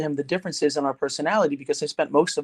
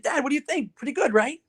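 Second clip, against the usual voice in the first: higher-pitched, lightly acted eager question; hopeful prompting; 10 semitones higher than usual. Son to negative dad at the packed party, hopeful. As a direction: nudge him hopefully, voice lifted.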